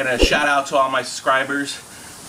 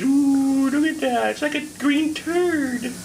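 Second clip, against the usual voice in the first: high squeal of mock delight; high excited; 11 semitones higher, slower than usual. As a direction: gush over it in a high voice. Gushing over the wasabi.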